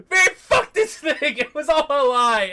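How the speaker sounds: high, loud ranting tantrum; acted high-pitched fury; mad Jinx. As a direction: rage in a squealing, hammed-up voice. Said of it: "just how mad she was".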